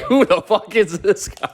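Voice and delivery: high, laughing, slow incredulous question; laughing mock disbelief. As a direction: laugh through the friends' reaction. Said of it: "They're like".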